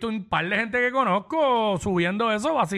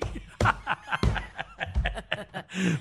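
risa; 5 dB quieter than usual. Real, not acted. Laughs after [name] joke.

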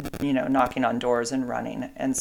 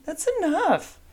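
much higher, firm call to the dog; scolding the dog; slower than usual. Real, not acted. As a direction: stop the dog right now, high and firm. Scolding dog mid-recording.

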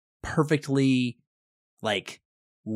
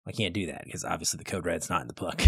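sheepish mumble; 6 semitones lower, 8 dB quieter, faster than usual. Retold or imitated. Marine's sheepish reply.

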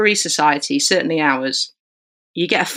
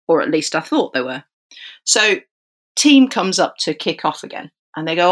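normal talking voice

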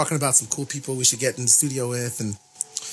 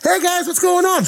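very high, loud cheery greeting; 6 dB louder than usual. Retold or imitated. Musician joining a three-way call, high cheery imitation. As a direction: chirp hello as a mock phone guest. Acting [name] answering the phone.

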